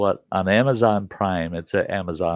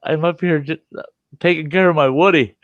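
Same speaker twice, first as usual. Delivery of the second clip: laughing through it, louder at the end; laughing answer; 8 semitones higher, 5 dB louder than usual. Retold or imitated. Man in the tree answering the neighbor, laughing. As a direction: try to say it without cracking up. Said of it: "I said".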